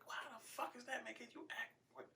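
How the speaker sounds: high, softened incredulous voice; baffled. Retold or imitated. Dad puzzled by his son's complaint, high hushed bafflement. Bewildered dad's retold reaction.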